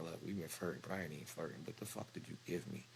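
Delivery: quietly pressing the nurse; hushed demand. Retold or imitated. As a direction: demand an answer without being overheard.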